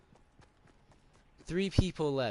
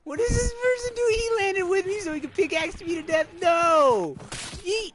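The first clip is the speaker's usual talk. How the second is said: high, loud, disbelieving yell; alarmed shout; 8 dB louder than usual. Real, not acted. Streamer yelling at a player who lands on him, high alarmed shout. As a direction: shriek it at the attacker.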